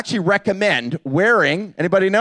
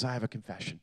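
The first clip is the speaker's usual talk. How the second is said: very soft, low mock confession; quiet confession; 9 semitones lower, 18 dB quieter, faster than usual. Son confessing to dad in silent van, very quiet. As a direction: drop to a near-murmur, imitating a guilty son. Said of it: "the whole van is quiet".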